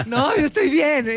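half-joking calm-down voice; mock reassurance. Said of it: "Y yo le decía a mi mamá".

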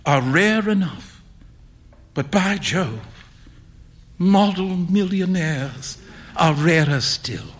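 slow, wry punchline. A narrator's reading. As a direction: land the aphorism slowly. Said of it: "remarked Alan".

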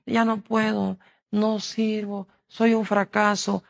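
mock discouraged whine. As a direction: mimic a defeated believer complaining. Y andan con que. (queja lastimera imitada, más aguda)

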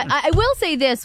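normal talking voice

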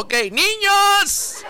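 put-on high, slow sing-song to little kids; mock schoolteacher calling the class; teacher voice. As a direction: talk to the audience like a kindergarten teacher. Addresses viewers as schoolchildren.

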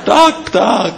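high comic chirp; squeaky agreement. Acted. Bird Pták agreeing with the snake, in a high chirp.

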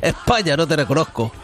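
low, outraged macho voice; Torrente. Pretend. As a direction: play a boorish, scandalised macho cop. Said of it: "Va a ver, Torrente".

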